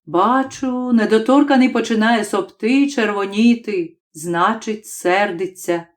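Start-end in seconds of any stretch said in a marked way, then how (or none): none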